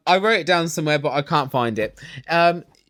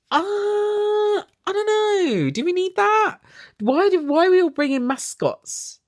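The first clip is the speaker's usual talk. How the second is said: sceptical. Real, not acted. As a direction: let the doubt rise way up high. Mocking the doll mascot.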